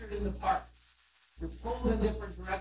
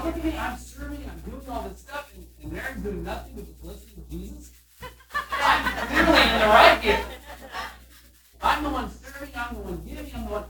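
higher, self-righteous put-upon voice; smug Martha; 3 semitones higher, 5 dB louder than usual. Retold or imitated. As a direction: play her as sure she's right. Martha's self-righteous inner thoughts.

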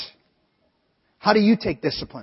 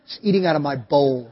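ordinary delivery

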